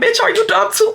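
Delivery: high-pitched incredulous challenge; hammed-up outraged comeback; indignant retort. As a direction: act out turning on someone in an argument. Acted quarrel with the manager.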